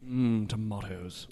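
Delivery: slow, low savouring hum; hammed-up relish in a low actor voice; Benedict Cumberbatch. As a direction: draw it out as a suave, mock-sultry star. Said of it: "Watch too much Sherlock".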